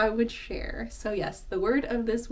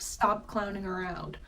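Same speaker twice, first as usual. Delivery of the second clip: usual speaking voice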